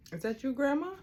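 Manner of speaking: calling loudly to another room. Real, not acted. Calling out to grandma.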